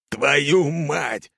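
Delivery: fierce bellow. A narrator's reading. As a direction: roar the curse in rage. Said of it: "свирепо горланит капитан".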